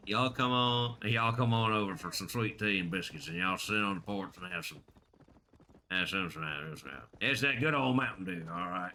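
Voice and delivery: put-on Southern country accent; mumbling Southerner. Streamer inviting folks over for sweet tea, mumbled Southern accent. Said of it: "you got me mumbling like a Southerner".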